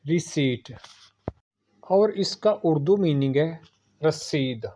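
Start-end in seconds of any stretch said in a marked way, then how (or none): 0.0-0.7 रिसीट with a silent p, ri-seet; रिसीट ending in seet; correct pronunciation. Shown as a demonstration. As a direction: say रिसीट without the p. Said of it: "Repeat after me".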